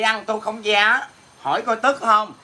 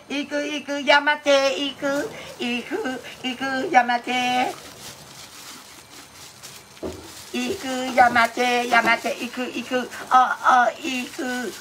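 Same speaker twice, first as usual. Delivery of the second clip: breathy, drawn-out fake Japanese cries, slower at the end; mock Japanese moaning; 3 semitones higher, slower than usual. Asked by a friend to speak Japanese, mock moaning. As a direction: ham up a silly anime-style moan. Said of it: "Su nói tiếng Nhật đi Su".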